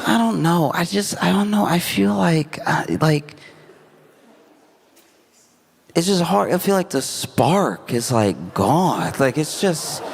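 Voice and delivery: high-pitched, halting acted confession; hesitant confiding daughter. Daughter confides marriage doubts to mom, hesitant high voice.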